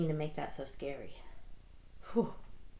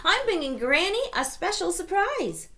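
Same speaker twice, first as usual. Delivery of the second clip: put-on little girl voice; 12 semitones higher, 6 dB louder than usual. Little Red speaks.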